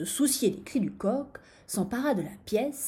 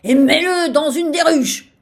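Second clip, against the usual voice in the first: loud, high angry command; furious shout; 7 semitones higher, 14 dB louder than usual. A narrator's reading. Sultan orders vizir to use the hives, furious shout. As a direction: yell the order at full force. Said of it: "cria-t-il".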